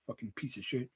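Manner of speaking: very soft grumbled curse under the breath; low grumbling aside; muttered curse. Real, not acted. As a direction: mutter it to yourself. Muttered insult after praise.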